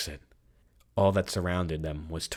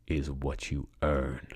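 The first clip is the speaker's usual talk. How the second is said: slow, deliberate verdict; drawn-out, controlled villain threat; calm menace; 3 semitones lower, slower than usual. A narrator's reading. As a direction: lean on each word without hurry. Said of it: "Luca calmly wrapped each finger".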